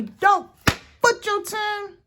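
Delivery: high, loud mock grandma scolding; grandmother's voice. Quoting grandmother to viewers, high loud mock scold. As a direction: bark it like an old grandmother. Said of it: "That's what my grandmother would say".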